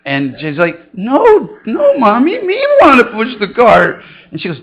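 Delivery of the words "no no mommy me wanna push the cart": put-on high, louder whining toddler voice; whiny toddler. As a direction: whine it like a stubborn little girl.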